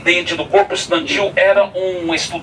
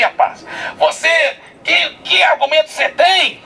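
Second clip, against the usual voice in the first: high, taunting know-it-all voice; mocking atheist student; 8 semitones higher than usual. Atheist student taunting the brother before the crowd, high mocking voice. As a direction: sneer at him in front of everyone. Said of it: "e ele rindo e falando".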